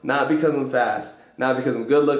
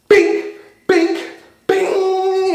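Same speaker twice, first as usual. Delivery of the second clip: high, loud bing bing; bright sound-effect bing; imitated bing; 5 dB louder, much slower than usual. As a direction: ring it out like hitting gold. Striking gold while digging.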